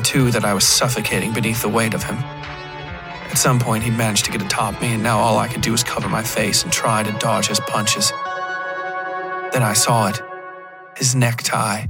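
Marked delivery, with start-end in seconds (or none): none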